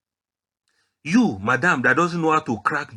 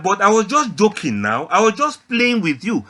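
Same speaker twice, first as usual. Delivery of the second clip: higher, louder voice pleading innocence; protesting husband; 7 semitones higher, 7 dB louder than usual. Acted. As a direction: act out an exasperated husband protesting. Husband protesting to offended wife.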